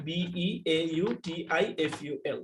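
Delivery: ordinary delivery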